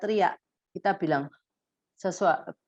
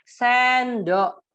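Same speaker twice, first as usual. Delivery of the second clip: put-on loud, higher model word for a child; 5 semitones higher, 5 dB louder than usual. Shown as a demonstration. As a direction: say it clearly to teach the child. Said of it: "Ibu di sampingnya bilang".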